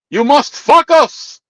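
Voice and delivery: slow high mock Greek accent; heavy Greek accent. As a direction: tease a friend with the coworker's accent. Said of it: "that heavy ass accent".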